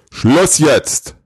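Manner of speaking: loud, high-pitched outburst; booming shout. A narrator's reading. Santa stops the endlessly chattering elf, booming shout. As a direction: cut him off with a booming command. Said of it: "Mit dröhnender Stimme rief der Weihnachtsmann".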